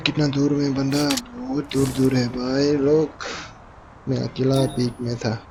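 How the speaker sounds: normal talking voice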